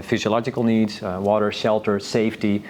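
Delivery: ordinary talk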